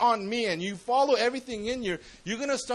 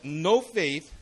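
normal talking voice

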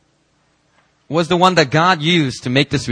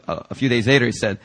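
ordinary talk

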